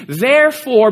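preacher driving the point home; emphatic therefore. Acted. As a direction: hammer the word "Therefore". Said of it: "repeated therefore emphasis".

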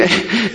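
quiet chuckle mid-story; amused laugh slipping out; real laugh. Real, not acted. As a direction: break off into a soft laugh. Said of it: "sorry, I was laughing".

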